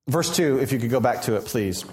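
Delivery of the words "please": usual speaking voice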